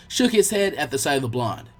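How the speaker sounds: usual speaking voice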